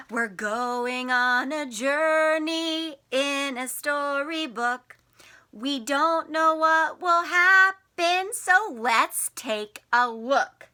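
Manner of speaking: slow, sing-song story opening; storybook intro. Acted. Teacher opens storytime for the children, slow and sing-song. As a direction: draw them into the story slowly.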